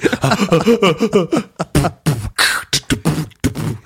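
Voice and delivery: puffed beatbox pops and clicks, breaking from laughter. Pretend. Podcast host jokingly demonstrates body percussion, beatboxing.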